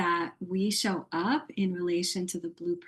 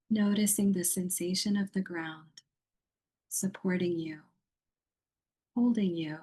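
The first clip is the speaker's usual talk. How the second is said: guided meditation. Acted. Yoga teacher guiding a muted online group into yoga nidra, meditative. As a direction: lead them gently into rest, phrase by phrase.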